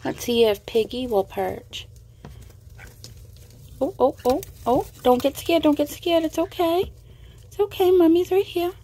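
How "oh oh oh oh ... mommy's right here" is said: high reassuring coo; calm a frightened little one gently. Real, not acted. Calming a frightened pet bird.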